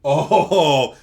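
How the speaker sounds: ordinary delivery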